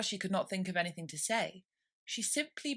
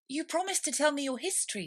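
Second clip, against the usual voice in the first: Alice voice; 9 semitones higher than usual. A narrator's reading. Alice character voice.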